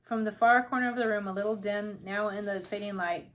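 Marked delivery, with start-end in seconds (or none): none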